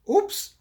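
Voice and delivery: very high, loud squeak. A narrator's reading. Pig squeaks as frog lands on head. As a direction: squeak in sudden alarm. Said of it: "says the small pig".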